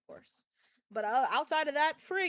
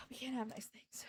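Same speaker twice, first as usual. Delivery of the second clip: sigh, then whisper it hopelessly; breathy defeated whisper; resigned whisper; 11 dB quieter than usual. Real, not acted. Streamer to chat, whispering a defeated lament.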